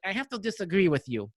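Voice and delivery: badly imitated Russian accent, measured and deliberate; heavy Russian accent. Retelling a fighter friend's objection, heavy Russian accent. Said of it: "in his heavy accent".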